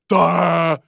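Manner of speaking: loud, drawn-out dumb bellow; Robbie. Acted. Host mocking a friend with an impression, loud dopey bellow. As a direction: bellow nonsense syllables as a crude impression. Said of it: "Robbie sounds like this".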